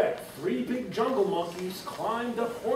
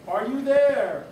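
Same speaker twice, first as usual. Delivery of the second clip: louder, higher, slow call; 6 semitones higher, 6 dB louder, slower than usual. Acted. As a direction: call out searching the clovers.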